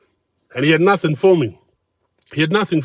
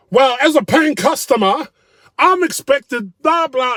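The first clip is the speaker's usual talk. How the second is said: much higher, slow pompous whine; higher mocking whine of an entitled diner; mock entitled customer; 12 semitones higher, 5 dB louder, slower than usual. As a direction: ham up a self-important complainer.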